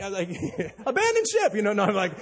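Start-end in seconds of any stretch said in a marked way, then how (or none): 0.8-1.5 high hammed-up cry of alarm; mock mayday cry. Joking captain radios the marina, hammed-up distress cry. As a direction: overplay the panic for laughs.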